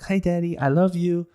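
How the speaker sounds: put-on high, sweet little kid voice; a child sweetly trying to win daddy's attention; super cute child. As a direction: play a small child being adorable for daddy. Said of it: "try to be super cute and like".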